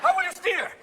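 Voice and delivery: very high squeaky question; incredulous questioner. Acted. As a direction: ask it way up high. Mock question to luge inventor.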